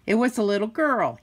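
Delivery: plain reading voice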